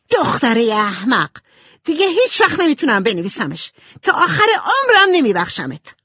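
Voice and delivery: high, loud, out-of-control fury; furious outburst. A narrator's reading. Jo to sister who burned her book, furious. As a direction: lose control and cry out in rage. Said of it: "جو کنترلش رو از دست داد".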